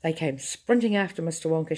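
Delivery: ordinary delivery, nothing marked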